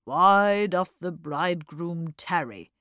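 slow, loud declamation; mock-solemn quoting man. Mug quoting as he flicks ash, slow declamation. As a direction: intone it like a grand quotation.

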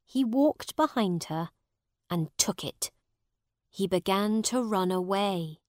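ordinary delivery, nothing marked

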